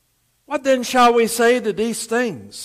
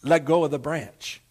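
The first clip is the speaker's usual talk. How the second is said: low, soft instruction; quiet, measured Lord; God's voice from above; 4 semitones lower, 4 dB quieter than usual. Acted. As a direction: give the order gently and low. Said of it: "And the Lord said".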